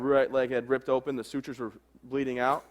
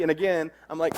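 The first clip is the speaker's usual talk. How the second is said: normal talking voice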